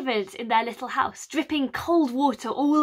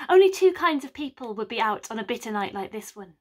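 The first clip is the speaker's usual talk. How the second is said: usual speaking voice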